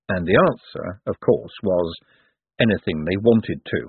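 ordinary delivery

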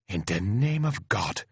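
mild, understated exclamation in an Irish voice; astonished Irish cry. A narrator's reading. Paddy taking in who the colonel is, astonished. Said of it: "cries Paddy".

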